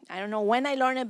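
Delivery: ordinary talk, nothing marked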